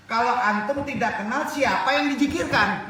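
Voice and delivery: high, loud preaching question; impassioned, insistent preacher; forceful challenge. Real, not acted.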